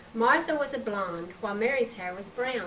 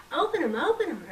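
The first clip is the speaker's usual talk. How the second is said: urging; 6 semitones higher, 3 dB louder, faster than usual. A narrator's reading. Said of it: "urged Emily".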